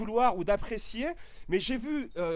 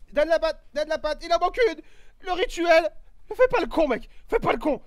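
urging the dog; 8 semitones higher, 6 dB louder than usual. Real, not acted.

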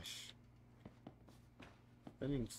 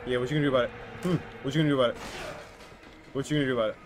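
loud cocky taunt; mock him loudly; 3 semitones higher, 10 dB louder, much faster than usual. Real, not acted. Taunting monster behind door.

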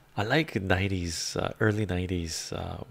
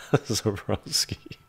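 ordinary talk, nothing marked

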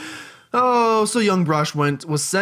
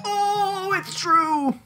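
put-on old lady voice; much slower than usual. Auntie voice.

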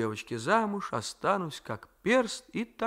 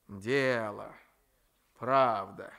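grumbling; 4 semitones lower, much slower than usual. A narrator's reading. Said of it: "ворчала бабушка".

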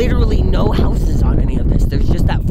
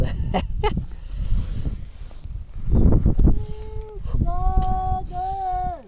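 laughing; 4 dB quieter than usual. Real, not acted. Laughing at the view.

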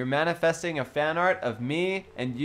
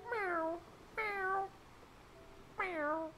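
very high, very soft meows; Gary meow; 13 dB quieter, much slower than usual. Acted. Asked to do Gary, tiny high soft meowing. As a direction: mew tiny and high like a cartoon snail. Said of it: "Can you do Gary?".

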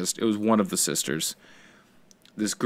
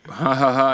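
imitated "ha ha ha" laugh, raised pitch; put-on higher-pitched chuckle; mock laugh; 4 semitones higher than usual. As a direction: fake a laugh in separate ha's. Retells the man laughing it off.

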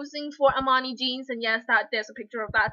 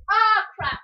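loud, higher exclamation; startled outburst; 6 semitones higher, 7 dB louder than usual. Real, not acted. Vlogger interrupted by her sister, loud outburst. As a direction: blurt it out, caught off guard.

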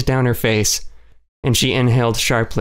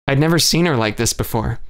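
plain reading voice

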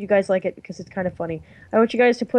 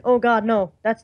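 normal talking voice

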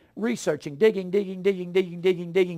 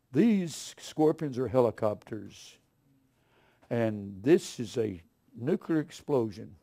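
mocking TV preacher; 5 semitones lower, 5 dB quieter, slower than usual.

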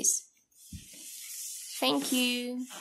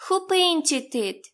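put-on reading to a toddler; 3 semitones higher, slower than usual. Teacher quizzes small child.

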